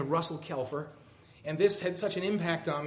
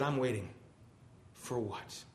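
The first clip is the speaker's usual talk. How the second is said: grumbling; 2 semitones lower, 8 dB quieter than usual. Acted. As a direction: grumble it under your voice, dropping away at the end. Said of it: "I grumbled to God".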